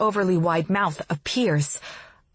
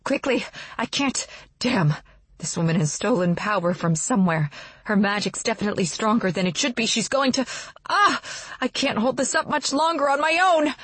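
straining; 3 semitones higher than usual. Acted. Straining to hold a shield.

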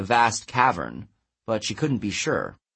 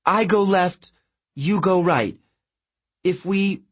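higher-pitched, halting urgency; anxious planning; 8 semitones higher than usual. A narrator's reading. Girl improvising tactics before the giant strikes, anxious. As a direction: grasp anxiously at a plan.

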